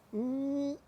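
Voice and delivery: high, soft mm-mm; appreciative hum. Real, not acted. Cook admiring the chicken, savoring hum.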